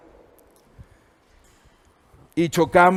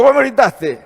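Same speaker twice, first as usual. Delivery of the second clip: higher, raised accusing voice; mock angry retort; 5 semitones higher, 4 dB louder than usual. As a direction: throw the blame back at him. Daughter's retort in the quarrel.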